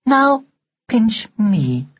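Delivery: high, prim and emotionless; an old-fashioned doll speaking without feeling; expressionless doll voice. A narrator's reading. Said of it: "Expressionless, as always".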